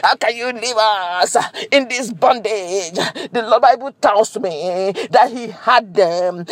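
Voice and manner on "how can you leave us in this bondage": groaning lament. Acted. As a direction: moan it like someone broken in bondage. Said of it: "when they groaned and said".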